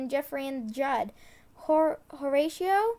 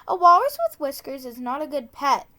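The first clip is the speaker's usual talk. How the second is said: louder and faster; 8 dB louder, faster than usual. Acted. Emphatic tongue twister line.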